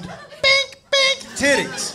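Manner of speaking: high silly bink sound effect; bouncing bink noise. Retold or imitated. Comic mimics bouncing breasts to crowd, silly high bink noises.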